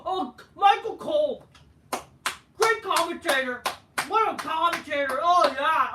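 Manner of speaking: loud hammed-up cheering; mock excited cheer. Vlogger mockingly cheers the commentator, loud hammed-up praise. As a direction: gush like an overexcited fan.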